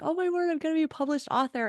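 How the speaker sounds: high, fast, thrilled burst of joy; excited gush. Retold or imitated. Wife hearing of her first book contract, gushing with excitement. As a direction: gush like the news just landed. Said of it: "I was so excited".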